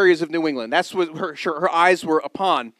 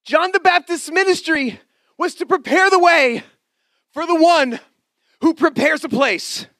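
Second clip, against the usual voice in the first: high, loud preaching with a repeated refrain; preaching at full force; 9 semitones higher, 3 dB louder than usual. Acted. Preacher proclaiming the refrain to the congregation, high and loud.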